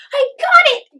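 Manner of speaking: very high, loud triumphant yell. A narrator's reading. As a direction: shout your triumph.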